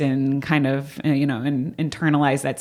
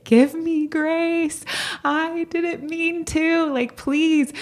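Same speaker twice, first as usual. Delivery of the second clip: high, slow, pleading voice near tears; a wife, sobbing, begs her husband for grace; tearful plea; much slower than usual. Retold or imitated. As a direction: beg for grace through tears, drawn out and high. Begging husband mid hormonal meltdown.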